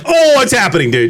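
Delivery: worked-up roommate quarrel; exasperated outburst. Acted. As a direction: blurt it out in mock disbelief, voice jumping up. Acted quarrel with roommate over missing things.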